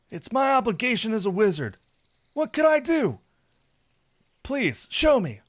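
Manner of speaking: very high, loud pleading; eager, curious Saphir. A narrator's reading. Saphir begging to see his gift, eager. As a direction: beg to see the surprise.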